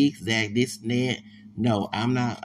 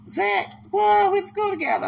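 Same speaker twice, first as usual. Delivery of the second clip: much higher, louder voiced friend; quoting old friend; 6 dB louder than usual. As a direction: pitch way up to play the surprised buddy. Old schoolmate quoted recognizing him.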